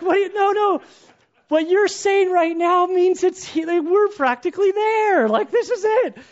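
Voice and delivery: very high, loud blubbering protest; mock crying. Weeping husband pleading with wife in labor, hammed-up crying. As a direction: wail it out, comically overwrought. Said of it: "I'm, like, crying".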